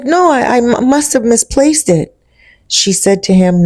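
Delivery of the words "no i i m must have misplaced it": nervous. Retold or imitated. Caught, stammering excuse.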